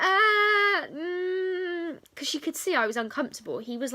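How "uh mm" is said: a high, loud, choked "uh, mm"; tearful wordless reply. Retold or imitated. Learner answering the examiner, high and loud, tearfully choked. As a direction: let the sound crack into a sob. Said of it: "in tears by this point".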